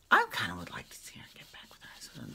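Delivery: fast whispered confession; a quick whispered aside. Real, not acted. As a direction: whisper it like a secret. Confiding aside to viewers.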